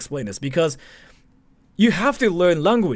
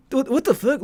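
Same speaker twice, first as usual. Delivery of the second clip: mock disbelief; 10 semitones higher than usual.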